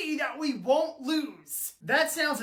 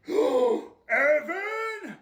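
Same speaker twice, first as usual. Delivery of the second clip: mildly louder, drawn-out angry call; 3 semitones higher, 4 dB louder than usual. Acted. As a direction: call out the culprit's name, slowly rising in anger. Angry father calling out his son.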